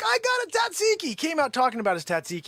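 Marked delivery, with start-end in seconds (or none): 0.0-1.1 very high mock-excited squeal. Gyro customer showing off his special sauce, squeaky mock excitement. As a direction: imitate a man thrilled to bits, pitched way up. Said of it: "He was so excited".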